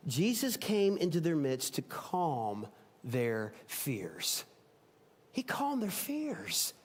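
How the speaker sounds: quiet tender emphasis; soft reflection. Real, not acted. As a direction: let the words settle gently. Preacher reflects on the story.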